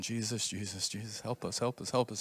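hushed, murmured pleading prayer; retold anxious praying. Friends praying at the airport counter, hushed pleading. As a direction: softly imitate the worried friends begging Jesus.

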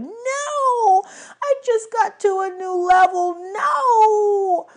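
high, whiny howl of protest; hammed-up tantrum wail; wailing satyr. A narrator's reading. Grover dragged from his game.

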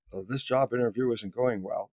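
soft, understated aside; hushed, deadpan realization after the uproar; little inner voice. Retold or imitated. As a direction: say it small, as a thought to yourself. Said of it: "a little voice going".

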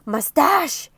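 loud exclamation; hurried cry. Acted. Mister Brave excusing himself before running off, hurried cry. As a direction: call it out as you rush away. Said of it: "he cried".

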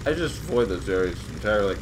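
talking while crunching crackers; chewing between words; mouth full. Real, not acted. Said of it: "Sorry, I'm eating crackers".

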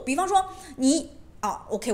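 normal talking voice

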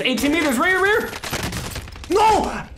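high slow panicked callout; screaming as the fight goes wrong; raging gamer. Real, not acted. Losing a close fight.

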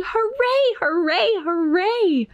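high, gleeful cheer at reading volume; storybook yell. A narrator's reading. Norma Jean was very happy at field day, high mock yell. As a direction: cheer it high, without real shouting. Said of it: "Norma Jean yelled".

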